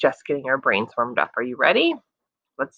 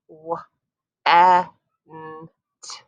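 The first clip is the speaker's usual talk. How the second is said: slow segmented word; separate segmented sounds; slower than usual. Shown as a demonstration. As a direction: break each sound apart slowly. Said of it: "I heard four sounds".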